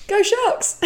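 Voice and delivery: high, loud hammed-up team cheer; mock cheer. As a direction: shout it like a pep rally.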